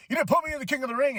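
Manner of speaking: high, rushed, indignant outburst; acted complaint. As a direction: fire off a high, mock-aggrieved protest. Acts Braun's angry complaint.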